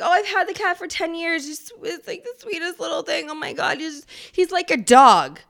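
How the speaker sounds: mock a doting cat lady, rising to a loud end; high mocking cat lover, loud at the end; gushing cat lady.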